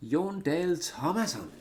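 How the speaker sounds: high, swooping Danish pronunciation. Shown as a demonstration. Streamer showing how Danes say a player's name, Danish voice. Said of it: "make that voice when speaking Danish".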